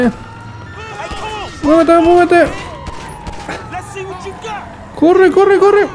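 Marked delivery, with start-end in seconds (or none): 1.6-5.9 very high frantic yelling; panicked screaming. Real, not acted. Chase at its peak.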